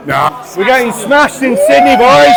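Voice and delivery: loud, high boozy yell. Real, not acted. Drunk lads shouting at camera.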